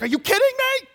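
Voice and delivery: a high, loud, incredulous outburst; shocked disbelief. Retold or imitated. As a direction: blurt it in shock. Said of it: "I'd be like".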